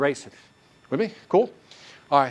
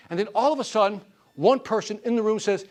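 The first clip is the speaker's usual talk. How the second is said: normal talking voice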